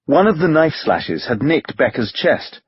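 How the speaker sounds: ordinary delivery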